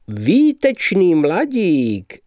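high, drawn-out admiring exclamation; enthusiastic. A narrator's reading. Optimist praises the departed young man, enthusiastic. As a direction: cry it out slowly, full of admiration. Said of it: "zvolal nadšeně pan Justic".

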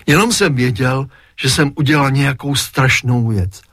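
ordinary delivery, nothing marked

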